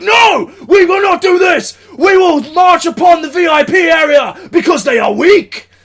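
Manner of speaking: high, loud hammed-up battle shout; mock warlord speech. Deposing the other leader, loud mock-heroic battle cry. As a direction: rouse the crowd like a general. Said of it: "And I was like".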